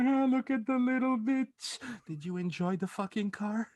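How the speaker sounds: high, taunting mock voice; mocking Liam. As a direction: sneer it out like a gloating bully.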